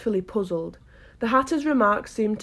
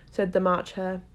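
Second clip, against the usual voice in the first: ordinary delivery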